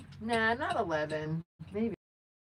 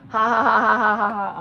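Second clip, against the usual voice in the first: big loud burst of laughter, imitating the friend on the phone; friend's mocking laugh, replayed loud; 3 semitones higher, 11 dB louder than usual. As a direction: laugh it out like he did at the joke. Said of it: "And he's like".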